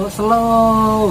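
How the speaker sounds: "slow" stretched right out; laid-back slow. Shown as a demonstration. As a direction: drag out "slow" lazily. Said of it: "nggak terburu-buru". (santai, kata "slow" dipanjangkan)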